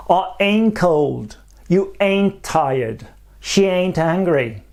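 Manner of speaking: slow, careful American demo with "ain't" said as "ɛnt". Shown as a demonstration. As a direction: read each line slowly, leaning on "ain't". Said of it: "une seule forme".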